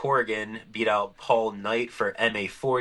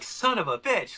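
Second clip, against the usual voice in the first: high-pitched mock cursing; retold dismay; 10 semitones higher than usual. Organizer reacting to baskets being moved, retold dismay.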